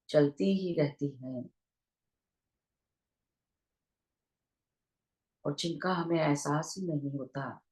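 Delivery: unhurried meditation guidance with long pauses; guided meditation. Real, not acted. Teacher leading online listeners into breath awareness, guided meditation voice. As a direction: guide listeners gently into their breath, leaving long silences.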